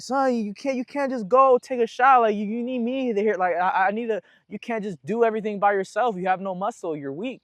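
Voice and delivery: lightly voiced mom telling her son off; scolding mom. Mom scolds him after he collapsed.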